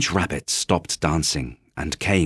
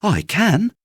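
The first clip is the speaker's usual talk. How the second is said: high, louder toy-rabbit insistence; little rabbit protesting; 13 semitones higher, 4 dB louder than usual. Acted. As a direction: pipe up to defend yourself. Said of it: "said the little rabbit".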